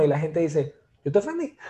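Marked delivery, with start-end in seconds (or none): 1.0-1.7 laughing mock quote.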